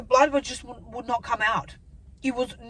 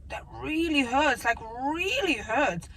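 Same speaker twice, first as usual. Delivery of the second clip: REALLY stretched out slowly; slow, heavy stress on really; emphatic retold complaint; 2 semitones higher, slower than usual.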